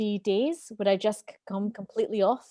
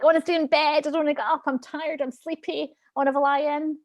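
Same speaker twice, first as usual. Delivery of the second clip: higher, lightly whining voice, fast at the end; whiny inner child; 6 semitones higher, faster than usual. Retold or imitated. Child [name] refusing to get out of bed, higher whine. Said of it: "child [name] would be like".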